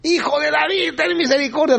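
high-pitched acted yell for mercy; insistent mock cry. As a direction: imitate a desperate call over a crowd. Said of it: "más grita este hombre".

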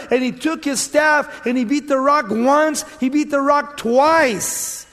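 high, loud, hammering pulpit voice; full-force preaching. Real, not acted. Preacher telling of Moses striking the rock, preaching at full force. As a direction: drive each blow home, stretching the last.

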